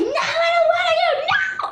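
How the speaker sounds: high, loud shriek of protest; hammed-up wailing refusal; mock crying tantrum. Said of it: "And I start crying".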